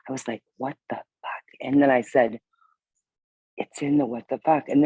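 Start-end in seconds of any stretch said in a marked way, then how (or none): 0.6-1.4 hushed curse. Retold or imitated. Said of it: "I can't cuss real loud".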